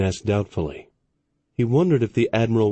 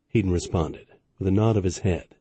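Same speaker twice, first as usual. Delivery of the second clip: ordinary delivery, nothing marked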